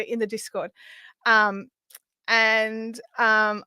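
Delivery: ordinary delivery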